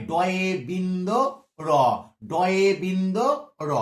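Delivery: ডয়ে বিন্দু র said slowly, each word clear; slow careful naming. Shown as a demonstration. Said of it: "তাই এটাও কিন্তু ডয়ে শূন্য নয়". (ধীরে স্পষ্ট করে "ডয়ে বিন্দু র" বলা)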